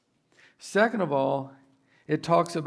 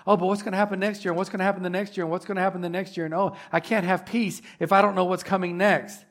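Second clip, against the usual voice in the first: fast, fretful worrying, a bit higher and louder; anxious fretting; 4 semitones higher, 5 dB louder, faster than usual. Pretend. Pastor voicing a fearful believer to the church, anxious and fast. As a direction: rattle off the worries of someone who can't rest.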